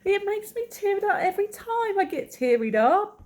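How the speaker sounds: high, gushing Cockney voice; squeaky mock-Cockney sentimentality; bit of Cockney. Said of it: "I just turned into a bit of Cockney".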